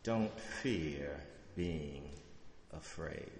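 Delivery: slow the line way down, quietly; slow and soft. Shown as a demonstration. Preacher repeating the line, slow and hushed. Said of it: "repeated slowly and softly".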